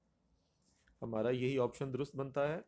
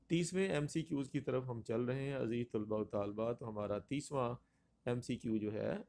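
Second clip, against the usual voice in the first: ordinary talk, nothing marked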